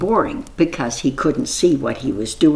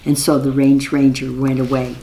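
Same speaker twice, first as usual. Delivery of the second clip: ordinary delivery, nothing marked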